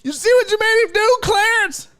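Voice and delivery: loud, high-pitched accusing yell; mock scolding shout. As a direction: holler the blame at a friend, playfully. Scolding Clarence over the hissing cat.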